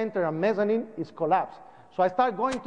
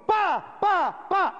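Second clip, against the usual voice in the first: higher, punchy pa pa pa crash noises; collapse sound imitation; 12 semitones higher, much slower than usual. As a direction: mimic the building crashing down.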